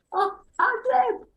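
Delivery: high, drawn-out mock astonishment; mock surprise. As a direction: squeal it slowly, feigning shock at being allowed. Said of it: "I said".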